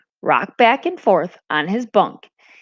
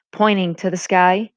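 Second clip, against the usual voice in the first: ordinary delivery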